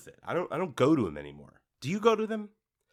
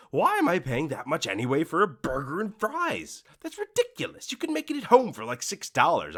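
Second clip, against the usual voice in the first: high, indignant disbelief; mock outrage at burger prices, pitched up; incredulous rant; 6 semitones higher than usual. Imagined indignant thought.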